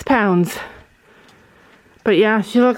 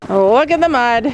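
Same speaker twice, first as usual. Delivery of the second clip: ordinary delivery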